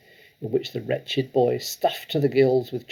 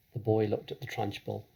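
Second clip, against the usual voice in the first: usual speaking voice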